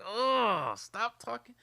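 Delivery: high, loud exasperated "oh, stop talking"; fed-up outburst. Retold or imitated. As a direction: blurt it out like you can't stand another word. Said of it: "I was like".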